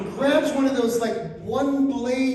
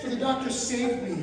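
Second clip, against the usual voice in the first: ordinary talk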